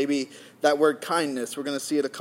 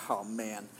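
much softer and lower, sinking; deflated groan; 8 semitones lower, 12 dB quieter than usual. Retold or imitated. Husband hears the scrape of metal while parking, deflated. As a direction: let the air go out of you as you realize the damage.

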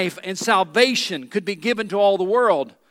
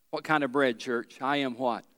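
ordinary talk

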